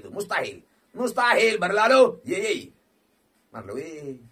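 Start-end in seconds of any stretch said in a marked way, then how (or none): 0.9-2.5 louder, drawn-out cry of disbelief. Real, not acted. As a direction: protest loudly that it can't be. Disbelief at empty spin. (teriak tak percaya)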